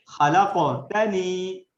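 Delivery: خلقتنی very slow and loud with a bold q; slow recitation. Shown as a demonstration. Teacher chanting Quranic words for students to repeat. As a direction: recite خلقتنی slowly, stressing the q. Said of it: "قاف بہت زبردست آپ ادا کر رہے ہیں".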